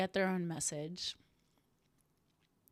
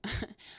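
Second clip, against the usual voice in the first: short breathy chuckle; a quiet, real little laugh; soft laugh; 4 dB quieter than usual. Real, not acted. Laughing to herself at the irony, soft breathy chuckle. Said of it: "I'm laughing 'cause".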